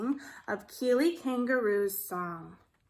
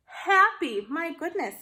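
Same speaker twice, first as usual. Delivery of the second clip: excited; 5 semitones higher, 6 dB louder than usual. Real, not acted. Excited discovery of word.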